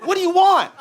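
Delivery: high, mock street salesman; a high, cocky clerk's "What, fam?". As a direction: pitch it up as a pushy clerk. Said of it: "I think he goes".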